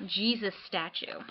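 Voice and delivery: ordinary talk, nothing marked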